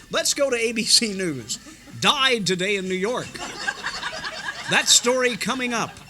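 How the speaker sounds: late announcer. Retold or imitated. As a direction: boom it out like a small-town DJ. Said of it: "And then he would say".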